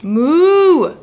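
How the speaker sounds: put-on a loud, drawn-out moo for the baby; cow moo. Shown as a demonstration. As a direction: moo like a cow to teach her. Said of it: "what does a cow say?".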